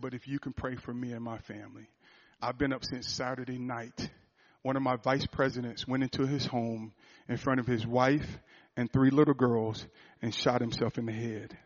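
low, hushed, heavy-hearted quote; retelling a crying man. Retold or imitated. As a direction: imitate a grieving man softly, pausing between phrases. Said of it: "And he's crying".

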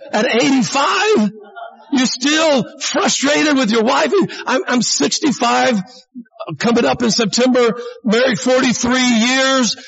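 retold high shout of disbelief; incredulous outburst. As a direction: act out a stunned friend shouting at him. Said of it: "I said".

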